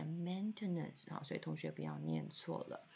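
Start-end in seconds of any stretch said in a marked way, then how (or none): 0.0-1.1 maintenance with the weight on MAIN; stress on first syllable. Shown as a demonstration. Teacher marking the stress for students, MAIN punched. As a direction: hit MAIN in maintenance, then let it fall. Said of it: "这个名字的重音在第一音节".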